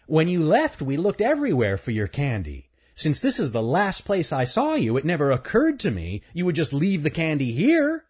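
the bully gloating; smug bully. Acted. Earl voiced.

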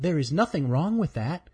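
ordinary delivery, nothing marked

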